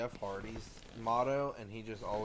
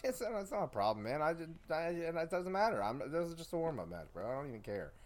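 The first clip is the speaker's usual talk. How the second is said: higher, hurried brushing it off; defensive excuse; 7 semitones higher than usual. Pretend. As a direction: play the sore loser shrugging it off. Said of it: "I'm like".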